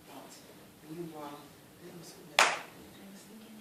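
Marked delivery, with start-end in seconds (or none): none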